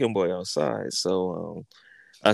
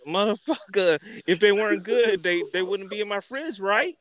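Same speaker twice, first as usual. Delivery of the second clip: mock exasperated retort; 10 semitones higher than usual. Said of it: "I was like".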